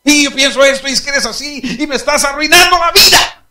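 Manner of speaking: high furious shout in his face; angry confrontation. Acted. Son shouting in his father's face, high and furious. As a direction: scream the accusation up close. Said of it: "si viene y le pone la cara aquí".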